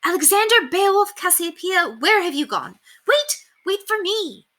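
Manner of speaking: put-on high, loud calls after lost children; frantic calling. A narrator's reading. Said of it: "frantically calling".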